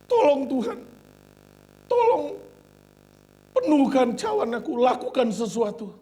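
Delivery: high, loud desperate crying plea; tearful pleading prayer. Retold or imitated. Preacher retells weeping prayer to God, loud tearful plea. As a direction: cry out to God begging to be used. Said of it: "saya masih nangis".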